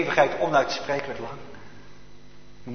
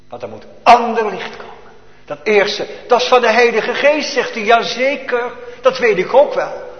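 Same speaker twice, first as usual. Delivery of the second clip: preaching at full force; 8 semitones higher, 7 dB louder than usual. Acted. Preaching sermon climax.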